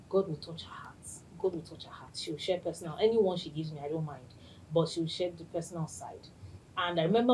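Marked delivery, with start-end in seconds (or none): 0.1-1.0 quiet prayer. Retold or imitated. Quietly praying to herself. 1.4-2.1 softly whispering the line; whispered prayer. Retold or imitated. Host whispering her hopeful prayer for her friend. 2.2-6.3 quiet prayer. Retold or imitated. Quietly praying to herself.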